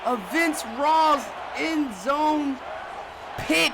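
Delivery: high, loud shout of disbelief; excited outcry. Real, not acted. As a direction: holler like the crowd just erupted. Interception right after a break.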